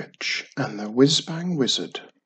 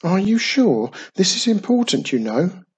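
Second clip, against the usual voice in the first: higher-pitched, doubtful check, easing down at the end; 6 semitones higher, 4 dB louder than usual. A narrator's reading. Wizard double-checking his count with witch, doubtful. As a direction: question it with worried insistence. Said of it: "he answered doubtfully".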